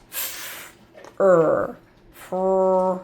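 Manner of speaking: F-er then fr-, the f sliding into r; blending sounds. Shown as a demonstration. Teacher blending the new sound for kids: f and r joined. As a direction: blend f and r into one onset. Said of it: "blend it with this sound right here".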